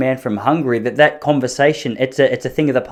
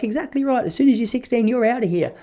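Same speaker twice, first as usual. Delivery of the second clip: high, quieter, clipped-off retort; mock curt mum; 9 semitones higher, 4 dB quieter than usual. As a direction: snap back as mum.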